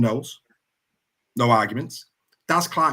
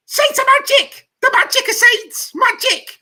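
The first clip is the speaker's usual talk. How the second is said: very high, loud, squealing fan voice; giddy Saints fan impression; 9 dB louder than usual. Host mimics a giddy Saints fan, squealing high and loud. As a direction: shriek it like an overexcited fan. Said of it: "it just wouldn't be a show of Super League Weekly without going".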